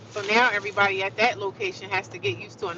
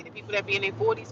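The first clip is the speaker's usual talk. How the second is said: normal talking voice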